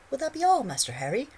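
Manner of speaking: very high, loud squeaky elf; eager, servile squeak; house elf voice. A narrator's reading. Dobby voiced.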